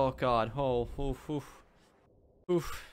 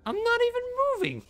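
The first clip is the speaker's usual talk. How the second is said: very high, louder squeaky protest; indignant squeal; 5 dB louder than usual. Real, not acted. Gamer protesting at creatures that bump into them, indignant squeal. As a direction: squeal in outraged disbelief.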